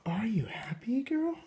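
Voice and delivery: whisper. Real, not acted. Whispering to baby.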